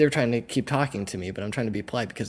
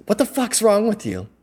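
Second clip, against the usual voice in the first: higher, louder angry outburst; retold yell, pitched high and confrontational; 11 semitones higher, 6 dB louder than usual. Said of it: "yelling at my face".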